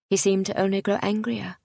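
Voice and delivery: usual speaking voice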